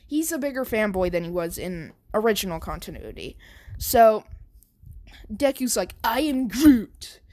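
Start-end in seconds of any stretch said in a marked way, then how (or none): 6.0-7.2 Groot voice. Acted. Deku offended, answers back.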